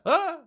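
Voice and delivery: short high yelp of dismay; sharp high "Ah!" of outrage; mock scream. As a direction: yelp like a let-down fan. Mock scream at Miguel's death.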